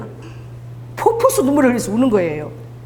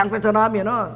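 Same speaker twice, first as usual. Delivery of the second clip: ordinary talk, nothing marked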